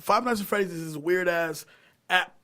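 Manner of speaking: ordinary talk, nothing marked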